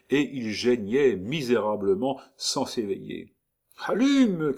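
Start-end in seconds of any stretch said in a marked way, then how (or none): 3.9-4.5 sharp high shout. Acted. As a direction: yell it out. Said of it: "cria Rudopoil".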